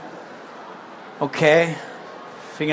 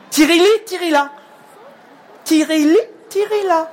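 birdsong call; 9 semitones higher than usual. Acted. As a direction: sing it like a songbird. Another bird calls.

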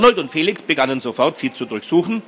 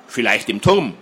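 ordinary delivery, nothing marked

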